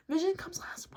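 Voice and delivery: soft, breathy whisper; hushed aside. Real, not acted. Repeats her point to viewers.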